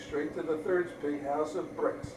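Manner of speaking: ordinary delivery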